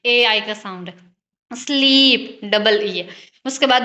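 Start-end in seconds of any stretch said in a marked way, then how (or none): 1.5-2.4 स्लीप with a long stretched ee; longer vowel sound. Shown as a demonstration. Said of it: "sound जो है वो longer". (स्लीप में ई लंबी खींचकर)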